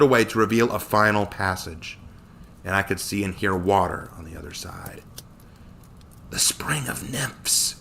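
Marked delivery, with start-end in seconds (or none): 6.3-7.8 slow, hushed whisper of awe; gasped wonder. A narrator's reading. Connor beholds the Spring of Nymphs, gasped wonder. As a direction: breathe it out slowly, whispering. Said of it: "Connor gasped".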